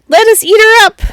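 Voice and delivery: high, loud squeaky cub voice; tiny bear's high squeak. A narrator's reading. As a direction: squeak it out like a fierce little cub. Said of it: "And Tiny Bear squeaked".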